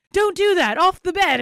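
high, loud, play-acted scolding; mock scolding yell. Acting out Johnny in the skit, mock scolding yell. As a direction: yell it like shooing a misbehaving pet.

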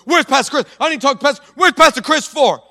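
high, loud frantic demand; imitating a woman storming the pastor's office. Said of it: "comes in and goes".

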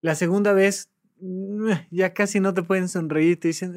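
normal talking voice

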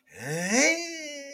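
long, high rising "heyyy"; high sustained vocal glide; imitated drawn-out sound. Shown as a demonstration. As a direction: stretch the sound out and hold it high. Imitated long noise of speed tailing off.

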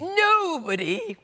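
high, slow, gleeful; gleeful whoop. Acted. King sliding down the banisters, high and stretched with glee. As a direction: sail down the banisters with joy. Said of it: "as he slid down the banisters".

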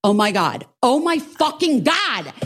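retold losing it. Customer losing it as driver asks what's happening, retold. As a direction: escalate into mock meltdown, stretching it out. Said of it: "lose my shit".

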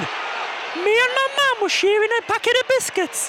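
high, slow Geordie comedian; Sarah Millican impression. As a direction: Geordie comedian shouting over football. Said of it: "I'm Sarah Millican".

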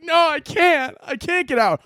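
put-on high, whiny little-kid voice; a high whine from a kid stuck in the dryer; whiny trapped kid. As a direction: whimper it like a stuck child. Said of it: "be like".

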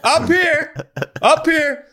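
high, drawn-out imitated catcher's call. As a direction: mimic a catcher signalling up high. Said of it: "the fucking catcher would just stand up".

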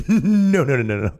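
mock emphatic refusal. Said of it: "It's like".

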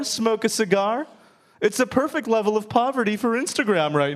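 high, gushing travel enthusiast voice; mock the gushing tourist raving about Cuba. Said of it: "Have you heard people talk like this?".

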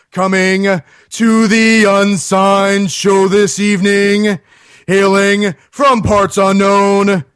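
slow booming circus announcer; ringmaster. Pretend. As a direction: ringmaster intro. Announcer-style band introduction.

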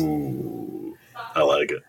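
distant off-mic voice; faint, far from the mic; from another room. Real, not acted. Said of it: "Did you hear that?".

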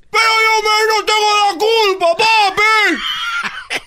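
hammed-up, piercingly high child's yell; screamed protest. Boy telling his dad it's not his fault, screamed protest. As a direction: plead innocence in a loud comic wail. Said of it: "¿por qué estás gritando?".

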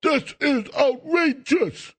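high, slow, drawn-out mock outrage; mocking whiny Republicans. Host mocking Republicans complaining about the prosecution, hammed-up whine. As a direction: ham up their fake indignation, stretching outrageous. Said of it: "all Republicans say".

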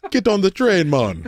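high, lilting mock Jamaican accent; Hitler in Jamaican accent. Parodying a dictator for his co-host, mock Jamaican accent. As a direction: put on a bad Jamaican accent. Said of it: "in a Jamaican accent".

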